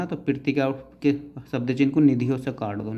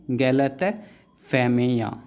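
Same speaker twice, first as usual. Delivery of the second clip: slow spelled-out word; much slower than usual. Shown as a demonstration. Teacher dictating गलतफहमियाँ slowly to students. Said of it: "गलत क्या outline".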